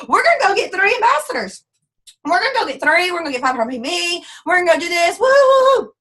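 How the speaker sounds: mock hype, high and loud, ending in woo; hammed-up rah-rah boast ending in a cheer; hyped-up cheerleading. Mocking a hyped recruit who boasts big numbers, loud and high. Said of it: "So if you say".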